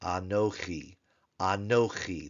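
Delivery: anochi with a guttural ch and the stress on the last syllable; Hebrew anochi. Shown as a demonstration. Teacher modeling anochi for Hebrew students, careful. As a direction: sound anochi out slowly and clearly. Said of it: "or anochi".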